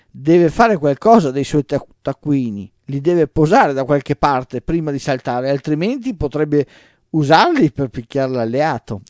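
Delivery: loud, lively teasing; animated joking. A narrator's reading. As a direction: pile on the joke with gusto.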